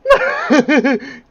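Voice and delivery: sudden high loud cackle; loud laughing burst. Real, not acted. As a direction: explode into laughter. Bursts out at video.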